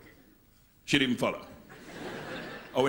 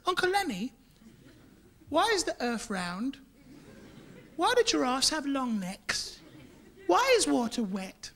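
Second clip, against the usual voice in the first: put-on higher, slow curious little kid; child's voice; 9 semitones higher, 5 dB quieter than usual. Small child questioning Uncle Len, curious child voice. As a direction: ask like a wide-eyed child. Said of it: "and they say".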